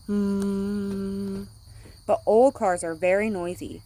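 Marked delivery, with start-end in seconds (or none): 0.1-1.5 long, slow, quiet hum; soft mm hum. A narrator's reading. Reading to children, slow soft engine hum. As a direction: hum gently like a new car. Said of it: "They make only a soft".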